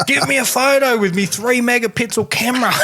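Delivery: high-pitched, hammed-up excited call; mock your younger self demanding a photo, pitched high.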